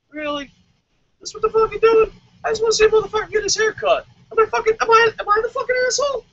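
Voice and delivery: a confused fan shrieking in disbelief; baffled crowd guy. Retold or imitated. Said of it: "you just hear in the crowd".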